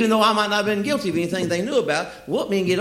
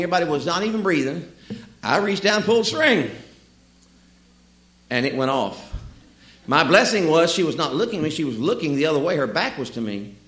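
normal talking voice

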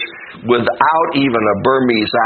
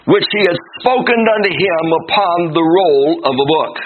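scripture reading; 5 semitones higher than usual. Acted. Preacher reading Jeremiah to the church, declamatory scripture reading. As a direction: read it out solemnly to a congregation, phrase by phrase. Reads scripture aloud to congregation.

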